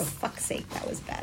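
whispered dismay. Real, not acted. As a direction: breathe it out under your breath. Dismayed aside under breath.

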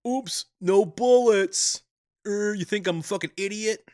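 1 mock McClane. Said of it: "And McClane is like".

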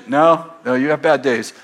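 ordinary talk, nothing marked

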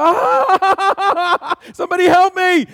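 hammed-up high, loud wail for help; mock panic. Preacher acting out his family's overreaction, mock-panicked scream. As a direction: play a comic screaming meltdown. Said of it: "if it was Tater Eye, we'd go".

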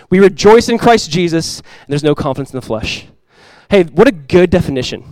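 ordinary delivery

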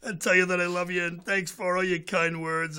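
a hint of the old mayor greeting an old friend; imitating Mayor Conway. [name] retells the mayor's phone call in his voice. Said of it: "you know what he sounded like".

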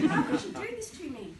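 loud, high mock despair; anguished cry. Mortified boy crying out inwardly at his mum, loud and high. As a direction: wail it like a mortified kid.

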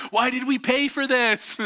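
high mock-panicked complaint; distressed wail. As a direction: whine it loudly as a regretful rafter. Scared rafters complain in rapids.

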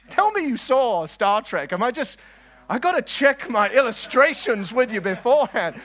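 high, loud playful scolding; mock exasperation. Preacher scolding listeners who missed Star Trek, mock exasperation. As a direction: plead in hammed-up despair at the crowd.

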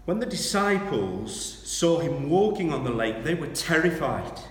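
loud, high, driving sermon retelling; preaching at full force. Acted. Preacher to church, dramatic storm retelling at full force. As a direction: build the storm scene with full preacher fire.

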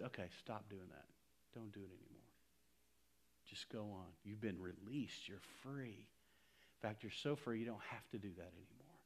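soft, gentle voice of Jesus releasing her. Acted. Jesus forgiving the woman caught in adultery, softly. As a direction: speak it quietly, like a kind release.

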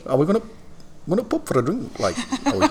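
high, sing-song mock Geordie; Newcastle accent. Shown as a demonstration. Host carries on his Newcastle attempt, Geordie accent. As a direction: fumble through a Geordie line, close to laughing. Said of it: "Can you do Newcastle?".

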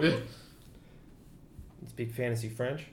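hushed mutter. Real, not acted. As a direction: mutter it under your breath. Player mutters dubious aside.

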